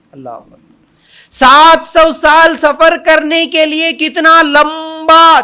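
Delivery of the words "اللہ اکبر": awed whisper. Real, not acted. Awe after the angel's size.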